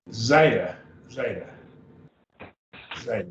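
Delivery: zeta said as ZAY-tah, buzzing the z off the teeth; teacher's model Greek. Shown as a demonstration. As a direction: spell out zeta with a teeth-vibrating z. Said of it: "A dental sound is right off your teeth".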